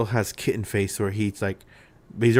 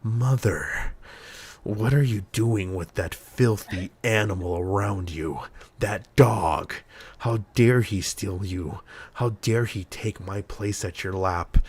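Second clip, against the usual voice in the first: put-on deep, offended mama's boy; slow, indignant cat voice, drawn out with outrage; Michael Shannon; slower than usual. Male cat confronting mother about the dog, deep offended voice. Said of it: "Michael Shannon deep voice".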